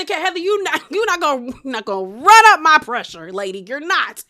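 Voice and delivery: punchy, hammed-up indignation with a laugh breaking in; sassy mock outrage, swinging high then loud; mock exasperation. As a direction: play up being fed up, hit the key words loud. Mock exasperated retort to Heather.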